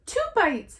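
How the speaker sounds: put-on high, bright child voice; little sister. A narrator's reading. Sister adds to the excitement, high child voice. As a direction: chime in like a little girl. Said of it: "said her sister".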